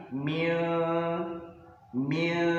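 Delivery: "mil" with a hidden nun, nasal glide; ikhfa nasal. Shown as a demonstration. Teacher showing ikhfa samar reading, soft mil. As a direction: blur the nun into "mil" softly.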